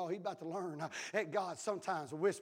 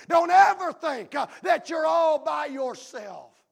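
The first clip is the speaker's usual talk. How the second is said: higher and louder, slowing into the peak; rising shout; 6 semitones higher, 10 dB louder, slower than usual. Acted. Pastor assuring the congregation, rising shout. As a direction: build it up and call it out. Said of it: "preacher rising to a shout".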